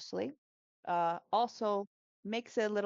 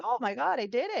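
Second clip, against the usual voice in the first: excited student; 5 semitones higher, 5 dB louder, faster than usual. Retold or imitated. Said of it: "They're like".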